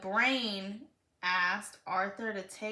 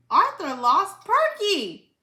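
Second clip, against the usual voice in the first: high, loud gleeful telling on him; loud tattling; 8 semitones higher, 9 dB louder than usual. Acted. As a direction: blurt out the news to get him in trouble. D W tattling to Mrs Wood.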